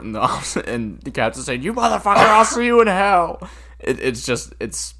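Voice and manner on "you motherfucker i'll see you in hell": high and loud, spitting the curse; mock furious yell. Quoting the captain yelling at the guy in his office. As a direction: roar it like an over-the-top movie cop.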